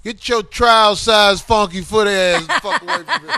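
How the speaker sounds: loud laughing yell; laughing shout. Real, not acted. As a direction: bellow it through laughter. Teasing insult over laughter.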